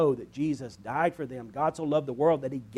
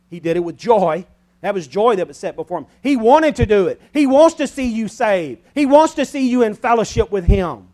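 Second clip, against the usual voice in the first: loud, high, pressing each wants; fervent preaching; 5 semitones higher, 11 dB louder than usual. Acted. Preacher urging the congregation that he wants them saved, fervent. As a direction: urge it on them with fervor.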